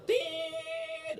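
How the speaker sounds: one long high sung beep of a flatline monitor. Shown as a demonstration. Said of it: "titik itu panjang dia". (bunyi panjang tinggi seperti monitor berhenti)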